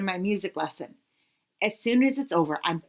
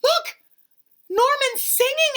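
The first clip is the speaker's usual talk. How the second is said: much higher and louder burst of excitement; excited; 6 dB louder than usual. Acted. Kid interrupting the lesson, much higher, excited call. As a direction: call out, thrilled. Kid amazed at singing fish.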